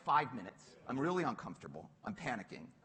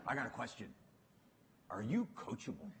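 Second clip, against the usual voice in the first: a hint of a gruff coach, lower; lightly voiced blunt boss, pitched down; Bill Campbell; 5 semitones lower than usual. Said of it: "This guy comes in".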